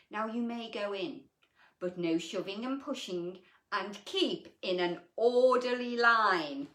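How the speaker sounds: a pompous elephant giving orders to the animals. Acted. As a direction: address the crowd like a self-important elephant. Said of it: "addressed the gathering pompously".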